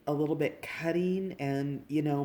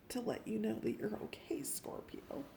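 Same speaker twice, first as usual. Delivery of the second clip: soft reassuring whisper, much softer; whispered comfort; 7 semitones higher, 8 dB quieter than usual. Real, not acted. Reader reassuring Scorpio it's okay, in whisper. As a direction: hush it gently to reassure.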